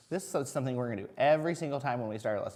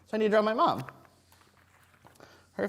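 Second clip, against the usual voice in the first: excited; 9 semitones higher, 6 dB louder than usual. Real, not acted. Excited teacher to kids.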